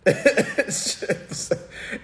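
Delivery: short, high breathy cackle; scoffing laugh. Real, not acted. Streamer scoffing at what people say, derisive laugh. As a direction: laugh it off in disbelief.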